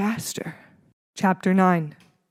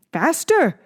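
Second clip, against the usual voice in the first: high, loud command; excited instructor yelling; cried out; 9 semitones higher, 5 dB louder than usual. A narrator's reading. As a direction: bark it out with rising urgency. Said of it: "the instructor cried".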